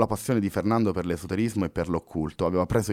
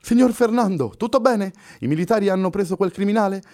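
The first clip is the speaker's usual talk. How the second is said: much higher and louder worried voice; woman rushing in to ask about the criminal; anxious woman; 12 semitones higher, 6 dB louder than usual. Acted. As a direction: burst in fretting.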